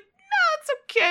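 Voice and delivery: very high, loud put-on voice; mock polite refusal. Host voices woman declining Ted's invitation, high-pitched mock refusal. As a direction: squeal the polite brush-off, imitating her.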